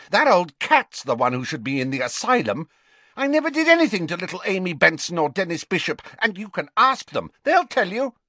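high, indignant protest; furious, defiant young Riddle, pitched high. A narrator's reading. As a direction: defend yourself in angry, rushed bursts. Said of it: "looking furious".

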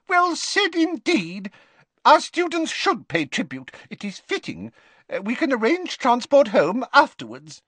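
very high squeaky professor; squeaky little voice. Acted. Flitwick urging the staff to consult governors, squeaky. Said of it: "squeaked Professor Flitwick".

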